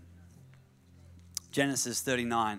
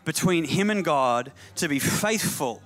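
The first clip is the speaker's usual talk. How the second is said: building to high, ringing sermon delivery; fervent pulpit climax; impassioned preaching; 9 semitones higher, 3 dB louder than usual. Real, not acted. Preaches at rising force.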